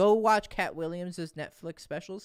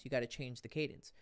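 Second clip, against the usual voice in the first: usual speaking voice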